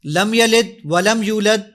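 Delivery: testing an app by misreading the verse aloud; deliberately wrong recitation. Shown as a demonstration. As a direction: recite لم یلد ولم یولد slowly and wrongly to test the app. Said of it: "میں اس کو غلط پڑھوں گا".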